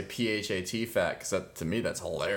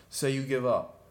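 higher, taunting push to surrender; teasing demand; 6 semitones higher than usual. Retold or imitated. To girlfriend while wrestling, a higher gloating taunt. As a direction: gloat as you squeeze.

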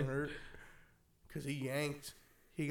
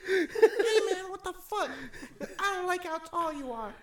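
high, loud, squeaky angry little man; pissed-off midget; 6 dB louder than usual. Pretend. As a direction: squeak your outrage up at the giant. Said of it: "It was just a pissed off midget".

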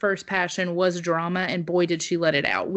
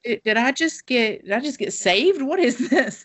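higher, laughing bewildered inner voice; half-laughing, stunned, can't believe it; dazed disbelief; 5 semitones higher than usual. Retold or imitated. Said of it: "I'm sitting here like".

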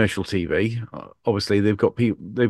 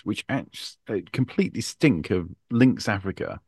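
ordinary delivery

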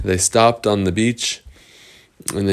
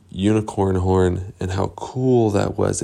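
plain reading voice

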